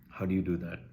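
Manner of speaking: usual speaking voice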